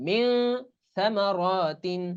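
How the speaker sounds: very slow drawn-out word; tajweed recitation. Shown as a demonstration. Teacher demonstrates ikhfa in slow recitation. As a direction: stretch it like a reciter. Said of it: "কেমন উচ্চারণ হবে?".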